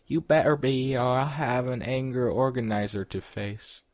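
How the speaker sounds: high, loud scolding voice. Acted. Frustrated Karen warns him to hurry, scolding. Redoes Karen's warning in character voice.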